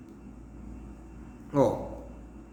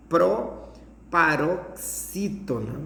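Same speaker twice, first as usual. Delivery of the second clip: "proparoxítona" very slow, broken into parts; syllable by syllable; much slower than usual. Shown as a demonstration. Teacher naming the word's class, "proparoxítona" broken up slowly. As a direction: spell out "proparoxítona" in slow chunks with pauses.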